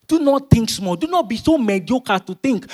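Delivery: high, loud preaching, near shouting; full-force preaching. Acted. Preacher to congregation, declaring in Jesus' name at full force. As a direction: rouse the congregation at the top of your voice.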